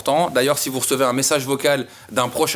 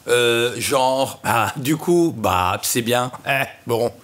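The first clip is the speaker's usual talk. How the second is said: tics de langage; 4 semitones lower, much slower than usual. Shown as a demonstration. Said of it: "ces petits tics de langage habituels".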